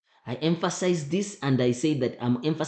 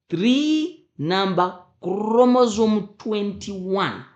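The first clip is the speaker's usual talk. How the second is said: slow, higher, drawn-out "three" and "chromosome"; emphatic teaching; 6 semitones higher, 4 dB louder, slower than usual. Shown as a demonstration. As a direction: hammer each term, stretching "three". Teacher hammers the specific exam wording.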